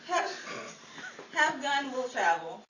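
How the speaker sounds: ordinary delivery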